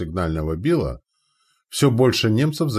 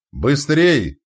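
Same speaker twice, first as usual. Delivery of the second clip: sharp loud shout; 6 semitones higher, 6 dB louder than usual. A narrator's reading. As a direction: shout for speed. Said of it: "прокричал Мартын".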